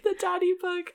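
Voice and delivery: high mocking echo.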